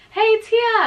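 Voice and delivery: loud, high imitated call; yelling up. Friend calls up when the ride stops, mock yell. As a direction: imitate someone hollering up to a friend. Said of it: "yelling up at me".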